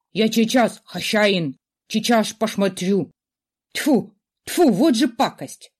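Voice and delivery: fussy little creature, spitting and grumbling; squeaky skull sputtering in disgust. A narrator's reading.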